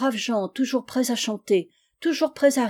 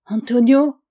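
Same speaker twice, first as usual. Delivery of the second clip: a loud, high cry of the name in fear; frightened cry; 3 semitones higher, 8 dB louder than usual. Acted. Girl seeing the hanging shadow, loud frightened cry. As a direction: scream his name as the dread hits.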